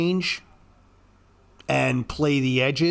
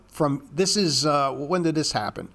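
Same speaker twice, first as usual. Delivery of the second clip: normal talking voice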